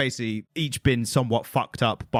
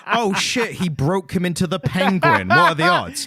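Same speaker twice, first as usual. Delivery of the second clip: higher-pitched, hammed-up shock; mock astonishment; 8 semitones higher, 5 dB louder than usual. Podcaster mocking the comic's Penguin twist, mock astonishment. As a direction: act amazed, rising higher and louder to the end.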